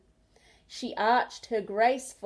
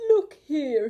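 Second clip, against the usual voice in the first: loud yawn through the words; sleepy koala yawning loudly; 5 semitones higher, 7 dB louder than usual. A narrator's reading. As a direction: stretch it out in a big yawn. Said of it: "he yawned".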